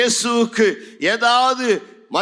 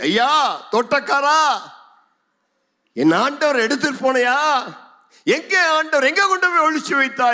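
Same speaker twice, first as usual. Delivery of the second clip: high-pitched, urgent questioning; pleading call; 8 semitones higher than usual. Acted. Mary calling to the supposed gardener, pleading. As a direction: call out high, demanding an answer.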